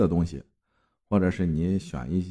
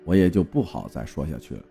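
louder, animated storytelling read with clear emphasis; story narration; 5 dB louder than usual. A narrator's reading. Host reading a listener's eerie hotel story to the audience, narrating. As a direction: read it out as a told tale, lifting each opening phrase.

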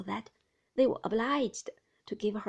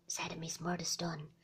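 usual speaking voice

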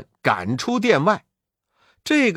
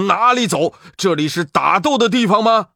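challenging shout; 4 semitones higher, 4 dB louder, faster than usual. Acted. Heavenly guards block the fighters.

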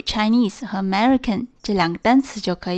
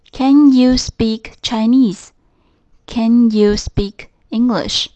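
Can stressed at the start, slow; 5 dB louder, slower than usual. Shown as a demonstration. Teacher modelling questions, slow, Can stressed first. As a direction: open with a stressed Can, unhurried. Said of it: "把can放在句首".

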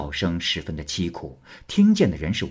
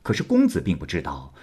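ordinary delivery

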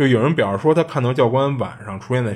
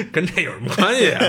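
laugh, then talk through it, higher; amused laughter spilling into the words; 7 semitones higher than usual. Real, not acted. Storyteller laughing at the co-host's joke about the name, laughing words.

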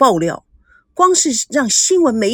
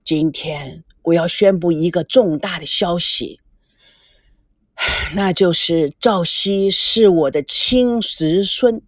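put-on grave old grandfather; 4 semitones lower, slower than usual. A narrator's reading. Grandfather announces big news.

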